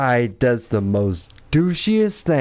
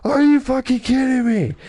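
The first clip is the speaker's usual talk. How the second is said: outraged disbelief; 11 semitones higher than usual. Retold or imitated. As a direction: lose it in disbelief. Retold rant at voice messages.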